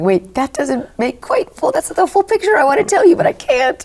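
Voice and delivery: put-on high, squeaky child bursting to speak, then whispered; mock child voice, pitch jumping up, fading to a hush; little girl voice.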